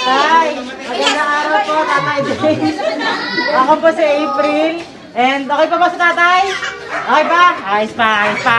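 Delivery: loud, high greeting; calling from far away. Real, not acted. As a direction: greet across the yard. Greeting across the yard.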